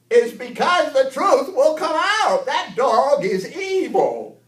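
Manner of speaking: high, loud, drawn-out holler; slow it down and shout the warning high; grandpa character. Pretend. Grandpa warning that the dog is evil, slow high holler.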